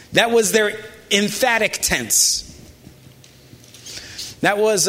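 usual speaking voice

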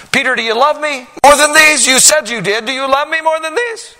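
loud, high, urgent questioning; Jesus demanding an answer, loud and high; pressing, pleading Jesus. Acted. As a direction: press him hard, voice straining high. Jesus pressing Peter, preached.

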